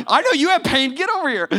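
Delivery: high, loud friendly challenge; calling out. Retold or imitated. Friend calling out his buddy.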